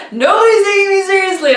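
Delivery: high, stretched-out mock complaint; indignant retold protest. Racer complaining that runners stepped in front, indignant.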